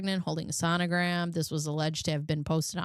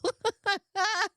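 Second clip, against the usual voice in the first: squeaky high laugh; high cackle. Real, not acted. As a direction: burst into a high-pitched cackle. Cackles at own joke.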